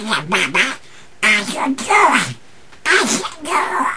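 high squawky cartoon duck; Donald Duck voice. Shown as a demonstration. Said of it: "another reel of Donald Duck".

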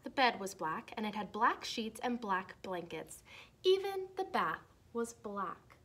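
lively storybook read-aloud voice; reading to children. Acted. Teacher reading a Halloween book to her class, lively read-aloud.

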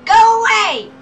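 high shouted "go away"; yelling. Real, not acted. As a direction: yell it high and loud. Fleeing police, yelling.

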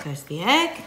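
usual speaking voice

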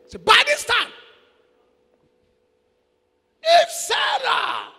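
high, loud preaching shout; preaching at full force. Acted. Preacher shouting warning to wives in church. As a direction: lift the voice and cry it to the church.

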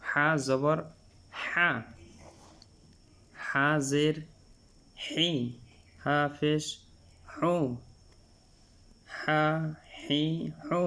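"হা, হি, হু" with air forced through mid-throat; Arabic big ha in "হা, হি, হু"; breathy mid-throat ha. Shown as a demonstration. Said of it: "গলার মধ্য থেকে শোষ করে".

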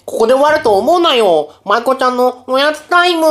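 high, loud show announcer. Acted. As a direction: announce it like a TV host. Announcing snack segment. (高く張ったバラエティ番組の司会)

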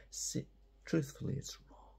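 unvoiced confiding aside; whispered, not for the students to hear. Real, not acted. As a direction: let them in on a secret, hushed. Aside to the class, lowered voice.

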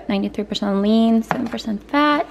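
usual speaking voice

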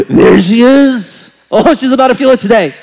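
worked-up, threatening excitement; gleeful menace. Retold or imitated. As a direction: crow it loudly, spoiling for a fight. Spotting the girl, worked up.

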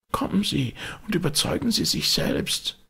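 put-on high, anxious old butler voice; frightened old servant. A narrator's reading. Poole's voice.

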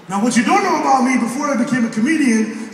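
ordinary talk, nothing marked

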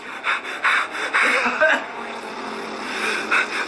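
high, eerie unvoiced laughter; a horrible laugh from the dark; breathy, eerie high cackle; loathsome laughing. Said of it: "a loathsome laughing".

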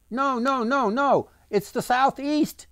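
high, insistent heckling yell; mocking yell. A narrator's reading. Islander heckles Lucas with an outlandish direction, high yell. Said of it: "yelled another".

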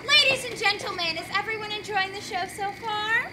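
announcer. Acted. Addressing audience at intermission.

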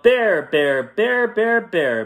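high rhythmic yell for a bear; storybook yelling; yelled call. A narrator's reading. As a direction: call it out loud in a quick chant. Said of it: "and he yelled".

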